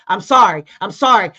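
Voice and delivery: put-on angry boyfriend shouting a grudging sorry; shouted apology. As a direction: bark the sorry out loud like a forced apology. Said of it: "when he said it like that".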